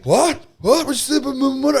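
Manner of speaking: high, loud indignant outburst; offended gang leader. Retold or imitated. Gang leader bristling at the salesman, high loud outrage. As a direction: bristle like he's been insulted.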